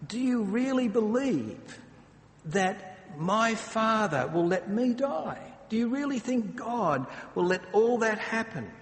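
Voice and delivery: a loving teacher gently questioning fear; gentle teasing reassurance. Shown as a demonstration. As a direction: kindly ask if they really doubt. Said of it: "It's almost".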